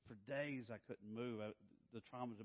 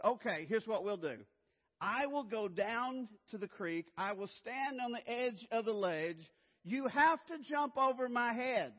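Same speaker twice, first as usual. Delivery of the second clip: loud instructions; 10 semitones higher, 11 dB louder than usual. Retold or imitated. Father instructing son at cliff.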